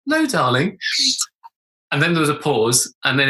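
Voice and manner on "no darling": put-on higher, sweet reply; highest voice. Dad answering daughter in ladies' loos, highest voice. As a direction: pitch it up to pass in the loos. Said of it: "said in my highest voice I could".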